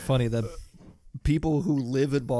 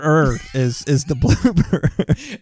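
slow, drawn-out mock; 3 semitones higher, 6 dB louder, slower than usual. Mocking slow drawl.

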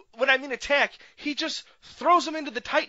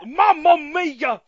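1 mock exclamation; 6 semitones higher, 7 dB louder, slower than usual.

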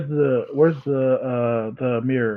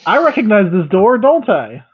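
higher, louder realisation; excited recognition; 6 semitones higher, 5 dB louder than usual. Real, not acted. Player recognises the door.

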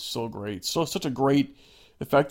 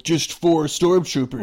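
slow, louder, higher smug taunt; sneering stormtrooper, drawn out; mocking trooper voice; 4 semitones higher, 6 dB louder, slower than usual.

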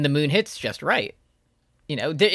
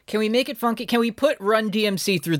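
excited producer voice; 8 semitones higher than usual. Retold or imitated. Repeating the producer quote.

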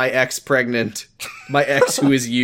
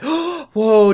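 gasp, then a high "whoa"; mock amazement; 9 semitones higher than usual. Listener reacts to the fanfic romance, mock amazed. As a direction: gasp in hammed-up wonder.

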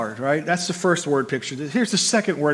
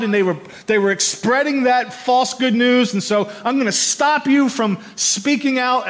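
full-force preaching; 6 semitones higher, 4 dB louder than usual. Acted. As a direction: drive it home like a revival preacher. Preaching about Saul's violence.

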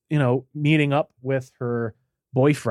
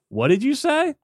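much higher, disbelieving question; can't believe what they just heard; mock outraged disbelief; incredulous what; 13 semitones higher than usual. Stunned couple's disbelief.

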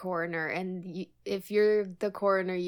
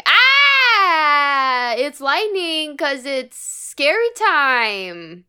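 spooky mock alarm; 7 semitones higher, 10 dB louder, much slower than usual. Spooky Halloween lightning warning.

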